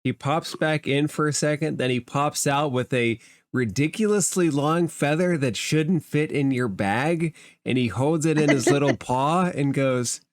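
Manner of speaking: ordinary talk, nothing marked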